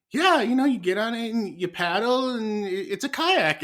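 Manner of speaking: put-on high, eager child explaining; high little kid voice. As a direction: play the kid proudly explaining. Son voiced explaining kayak.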